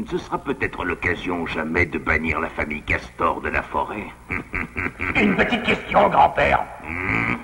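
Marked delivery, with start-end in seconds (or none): 4.1-5.1 low scheming snicker; sly low chuckle. Acted. Schemer plotting to banish the beavers, low chuckle. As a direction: chuckle over the wicked plan.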